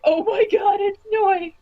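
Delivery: excited fan squeal. Retold or imitated. Retelling hearing favorite voice.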